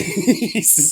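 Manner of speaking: high, squeaky burst of laughter; high-pitched giggle. Retold or imitated. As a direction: giggle up high in mock disbelief. Acting out friend's amazed reaction.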